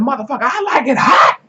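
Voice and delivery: loud, fierce taunt. Retold or imitated. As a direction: spit it out defiantly. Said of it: "she got real mad".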